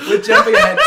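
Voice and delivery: high loud laughing protest. Real, not acted. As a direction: yell it to rein the chat in.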